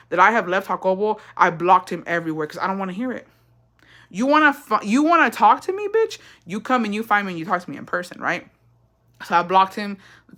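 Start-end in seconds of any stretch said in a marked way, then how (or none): 4.1-6.2 higher, taunting challenge; sassy challenge. Retold or imitated. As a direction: throw it out like a dare, higher. Mock challenge, high pitch.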